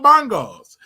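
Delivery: laughing through the word. Real, not acted. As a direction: say it while cracking up. Laughing at the Bongos mix-up.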